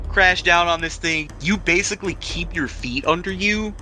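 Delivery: usual speaking voice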